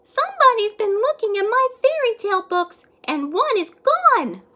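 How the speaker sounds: put-on very high, loud little-child voice; high baby voice; Baby Librarian. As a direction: pipe it up like a small upset child. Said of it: "Then Baby Librarian said".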